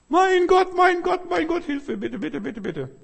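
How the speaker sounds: high, loud, frantic cry for help; shrill desperate plea that fades to whimpered bitte; desperate pleading. Acted. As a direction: beg God as if drowning. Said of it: "flehte er zu dem Herrn".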